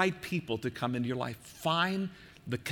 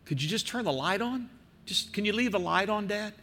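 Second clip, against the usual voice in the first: lightly voiced little girl, higher pitch; imitated pleading child; 7 semitones higher than usual. Little girl afraid of the dark asks dad, lightly imitated plea. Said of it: "they would yell out".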